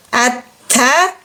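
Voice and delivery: "At, ta" with a light, thin ta, no heaviness inside. Shown as a demonstration. Teacher demonstrating istifal, "At, ta" kept thin. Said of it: "tidak ada suara tebal-tebal di dalam".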